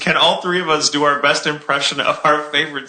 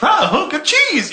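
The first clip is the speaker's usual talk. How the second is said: much higher hollered cowboy cartoon; cheerful higher cartoon shout; cartoon cowboy cheese; 13 semitones higher than usual. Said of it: "and he's like".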